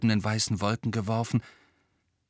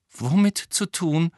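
higher, slow anxious question; worried, drawn-out and pitched up; uneasy; 9 semitones higher, 5 dB louder, slower than usual. A narrator's reading. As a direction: ask it with dread creeping in. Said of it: "fragte Hermine beklommen".

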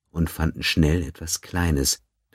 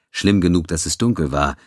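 ordinary delivery, nothing marked

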